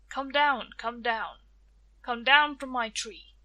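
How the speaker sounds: loud chanted spell; magic words. Acted. Tia freeing Death from her tree, loud spell chant. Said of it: "She said the magic words".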